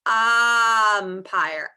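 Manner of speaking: "umpire" with a long, loud "um"; stressed first sound. Shown as a demonstration. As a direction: hold the opening vowel of "umpire". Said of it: "let's talk about the first sound".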